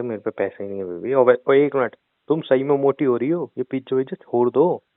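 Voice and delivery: ordinary delivery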